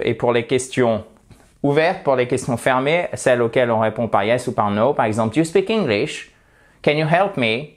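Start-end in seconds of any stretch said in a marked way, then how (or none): 5.3-7.7 "Do you speak English? Can you help me?" qui monte à la fin; intonation montante. Shown as a demonstration. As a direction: fais grimper la voix sur "English" et "me". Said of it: "elle monte". (questions fermées, "English" et "me" vers l'aigu)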